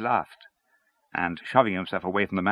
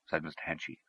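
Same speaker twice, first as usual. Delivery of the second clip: usual speaking voice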